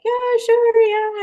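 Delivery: awkward agreement. Retold or imitated. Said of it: "And so I'm like".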